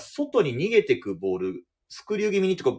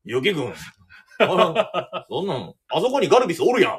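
a light Kansai-dialect manager, louder and amused; 2 semitones higher, 5 dB louder than usual. Retold or imitated. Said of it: "取材対応関西弁で". (関西弁で監督が記者をからかう、少し強めに)

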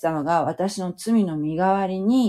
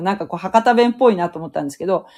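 normal talking voice

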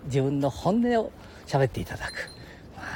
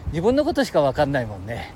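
ordinary talk, nothing marked

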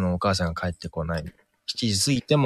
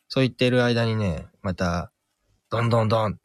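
ordinary talk, nothing marked

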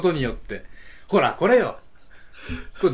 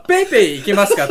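too loud at the register, laughing; asking way too loudly; 5 semitones higher, 10 dB louder than usual. Retold or imitated. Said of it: "めちゃくちゃでかい声が".

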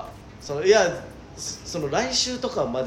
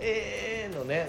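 high, drawn-out raspy "eee"; 6 semitones higher, 6 dB quieter, much slower than usual. Retold or imitated. Partner echoing the detective impression, raspy drawn-out voice. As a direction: imitate the croaky stretched "eee". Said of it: "ガラガラ声。".

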